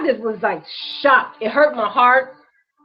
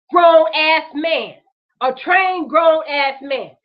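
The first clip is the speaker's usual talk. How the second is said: "grown-ass" drawn out slowly; slow down and lean on "grown-ass man"; slow heavy stress on "trained grown-ass"; slow emphatic stress; much slower than usual. Real, not acted. Stressing the officer's size.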